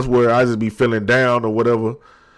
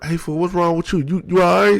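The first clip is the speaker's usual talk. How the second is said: higher-pitched mock concern from a buddy; imitating a worried friend; 7 semitones higher than usual. Friend asking why he's crying, higher mock concern. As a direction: play the friend checking in on him, voice lifted. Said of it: "I don't want nobody like".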